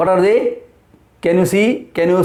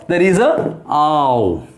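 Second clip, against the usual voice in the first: ordinary delivery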